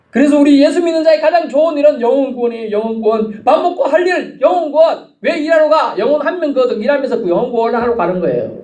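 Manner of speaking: high, driving pulpit exhortation; fervent preaching. Real, not acted. Preacher telling believers their best work is saving souls, fervent preaching. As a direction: hammer each phrase out high.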